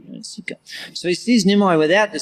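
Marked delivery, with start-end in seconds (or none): 0.1-0.6 breathy whisper, no voice at all; hushed whispered repeat; whispered aside. Shown as a demonstration.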